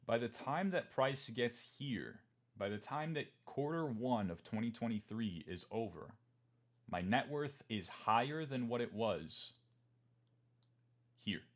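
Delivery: slow, weighty restatement with pauses; drawn-out emphatic repeat of the point. Shown as a demonstration. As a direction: lean on every word for emphasis. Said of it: "Let's just pause for emphasis".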